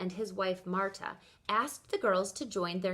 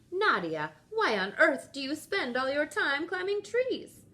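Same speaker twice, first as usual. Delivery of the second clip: grandmother asking; 7 semitones higher, 3 dB louder than usual. A narrator's reading. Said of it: "her grandmother asked her".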